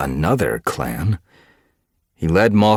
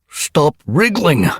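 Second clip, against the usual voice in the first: slow, much higher, louder scolding yell; angry shout; 13 semitones higher, 9 dB louder, slower than usual. Acted. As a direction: snap at a squirming kit. Unseen cat scolds a kit.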